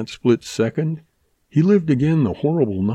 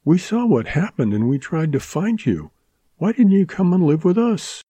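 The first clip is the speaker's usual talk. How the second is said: put-on higher girlish voice, rising urgency; young woman Mary, pleading; 8 semitones higher than usual. A narrator's reading. Mary asking Dan why he never came, higher pleading. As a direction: press him gently as a hurt girl.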